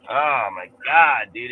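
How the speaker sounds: ordinary talk, nothing marked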